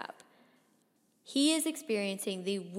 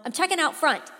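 raised, higher urgent voice; urgent call-out; 7 semitones higher, 9 dB louder than usual. A narrator's reading. Boy telling dad he's going out front, urgent call. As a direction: call it out loudly on the move.

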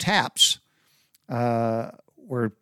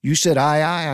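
sharp, louder questioning bark; incredulous commander; 5 semitones higher, 6 dB louder than usual. Retold or imitated. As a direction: demand it back in disbelief. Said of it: "He said".

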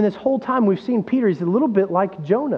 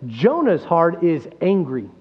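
ordinary delivery

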